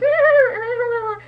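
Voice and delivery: loud, high-pitched goofy character laugh; put-on laugh, high and loud; Arnold Cunningham laugh. Shown as a demonstration. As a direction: burst into a high, awkward solo cackle. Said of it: "it's like an Arnold Cunningham laugh".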